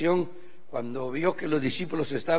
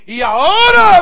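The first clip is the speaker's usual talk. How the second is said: wife amazed; 7 semitones higher, 14 dB louder, slower than usual. Retold or imitated. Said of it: "me dice".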